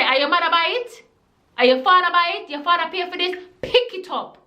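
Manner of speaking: pushing the bully, patois boiling over; angry patois. Retold or imitated. Boy ordering the bully to pick up his jacket, angry patois. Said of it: "I'm now speaking in my patois now".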